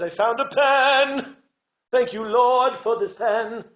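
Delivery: high, loud, slow grateful cry; joyful thanks. Shown as a demonstration. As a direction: savour each word with delight. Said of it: "we sing them".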